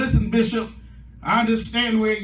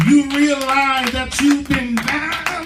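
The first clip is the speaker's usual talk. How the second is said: loud, raised-pitch sermon climax; full-force preaching; 4 semitones higher, 6 dB louder than usual. Real, not acted. Preacher rallying the saints to a new beginning, full-force preaching. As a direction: drive each phrase home, stretching the big words.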